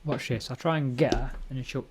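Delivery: ordinary delivery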